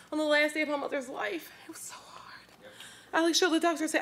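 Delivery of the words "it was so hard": a broken, breathless whisper. Real, not acted. Daughter recalls hospice day, whispering in grief. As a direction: let the words fall away into a whisper.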